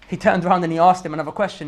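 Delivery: ordinary delivery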